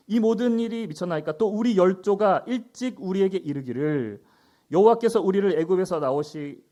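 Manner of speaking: loud, declaimed lament from the Bible; projected formal reading; scripture reading. Acted. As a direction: read Gideon's reproach out with force. Reads scripture aloud.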